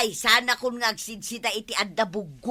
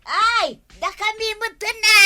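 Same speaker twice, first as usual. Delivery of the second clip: high, loud and quick, higher pitch; excited outburst; 8 semitones higher, 5 dB louder than usual. Acted. Protesting to the nurse about the list, high and rushed. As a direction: blurt it out in a rush.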